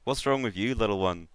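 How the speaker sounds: falsely concerned soul collector; Baron Vol. Acted. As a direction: coax a frightened little one in character. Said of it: "Baron Vol tries to peer".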